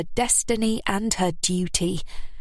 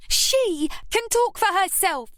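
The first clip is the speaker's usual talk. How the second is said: put-on high, sharp young voice; snapped; 13 semitones higher than usual. A narrator's reading. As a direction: snap it back at her. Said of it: "snapped Scarlett".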